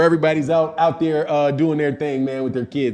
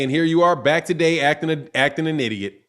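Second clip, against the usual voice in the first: ordinary talk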